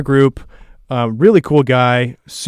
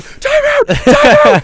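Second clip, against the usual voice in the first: much higher, louder desperate yell; yelled plea; 4 dB louder, much slower than usual. Retold or imitated. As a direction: scream at the coach to stop the clock. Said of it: "'Cause I was yelling".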